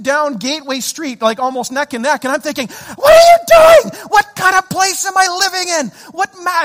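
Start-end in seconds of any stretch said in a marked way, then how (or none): 3.0-6.6 high, loud road-rage yelling; furious shout. Retold or imitated. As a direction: explode at the driver alongside, re-enacted. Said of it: "I'm getting super mad".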